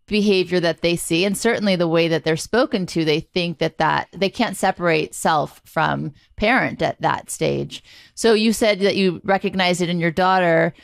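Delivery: ordinary talk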